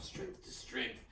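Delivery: unvoiced, breathy whisper of the mantra. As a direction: breathe it softly into her ear. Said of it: "I'm whispering in her ear".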